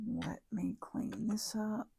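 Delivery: soft whisper to herself; whispered under the breath; whispered aside. Real, not acted. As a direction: breathe it out while tidying. Aside while tidying the craft desk.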